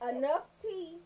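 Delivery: ordinary delivery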